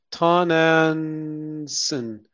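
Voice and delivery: Tanansin drawn out very slowly, syllable by syllable; sounding out a name. Real, not acted. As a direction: feel your way through an unfamiliar name, Tanansin, stretching it. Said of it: "no idea how to pronounce it".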